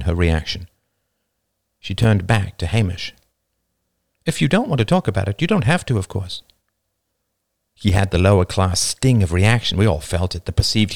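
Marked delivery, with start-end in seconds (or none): 4.3-6.4 put-on higher, quick, considerate young character; Jane, gently accommodating.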